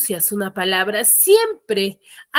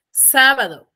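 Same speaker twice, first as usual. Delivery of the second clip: "Sábado" with the first syllable higher and louder; stress on SÁ; 4 semitones higher, 5 dB louder than usual. Shown as a demonstration. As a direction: punch the "Sá" of "Sábado", then let it fall. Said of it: "dónde tiene la mayor fuerza de voz".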